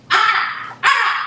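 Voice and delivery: high squawking gull call; high ah ah seagull squawk; seagull cry. Acted. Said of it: "the way that Oscar introduces himself".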